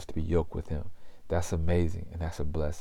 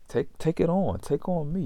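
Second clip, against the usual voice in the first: higher, urging invitation; a gently pleading, higher-pitched offer; inviting call; 10 semitones higher than usual. Acted. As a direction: lift it up as if coaxing them in. Voicing Jesus' invitation.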